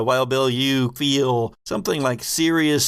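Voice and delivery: ordinary delivery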